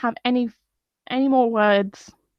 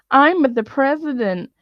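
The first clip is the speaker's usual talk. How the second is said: imitating a whining Trump, rising to a high, louder cry; whiny mock Trump; 6 semitones higher, 5 dB louder than usual. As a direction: whine it like a spoiled man-child. Mocking Trump's whiny tweet.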